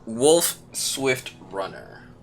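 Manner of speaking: normal talking voice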